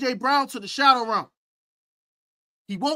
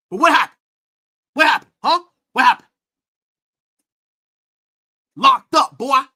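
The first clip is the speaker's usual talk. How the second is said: taunting; 4 semitones higher, 8 dB louder than usual. Real, not acted. Taunting rival fan, rising.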